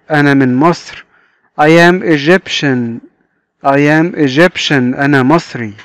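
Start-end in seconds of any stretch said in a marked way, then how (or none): 1.6-4.9 very slow, word by word; drilled slowly in a lesson; slow model reading. Shown as a demonstration. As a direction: spell the sentence out slowly for kids. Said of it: "teacher modelling sentence".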